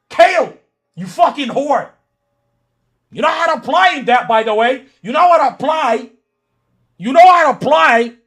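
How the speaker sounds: loud, heated insults; angry yelling. Real, not acted. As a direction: keep shouting him down. Heated argument in chat.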